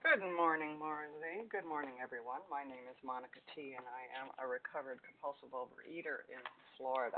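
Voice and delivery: very quiet, tinny phone line; like talking from inside a tin can; tin can sound. Real, not acted. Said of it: "you sound like you're in a tin can".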